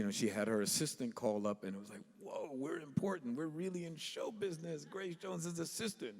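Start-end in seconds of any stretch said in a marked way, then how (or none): 2.2-6.1 hushed, higher-pitched excited awe; softly marvel, pitch lifted high; starstruck. Retold or imitated. Young musicians thrilled at a star's assistant calling, starstruck.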